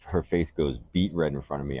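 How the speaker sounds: ordinary delivery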